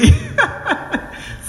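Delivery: a bright, high delighted laugh; high burst of laughter. Real, not acted. Woman laughing as she tells her pastor friend, high delighted laugh.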